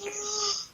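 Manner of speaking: sharp high theatrical gasp; mock gasp. Mock gasp at the witch accusation, hammed-up. Mock shock at Delphi magic.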